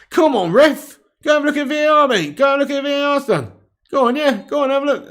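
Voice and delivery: high, loud begging at the ref; pleading with the referee. Real, not acted. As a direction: keep urging the ref to look.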